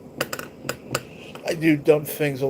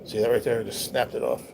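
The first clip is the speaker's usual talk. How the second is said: usual speaking voice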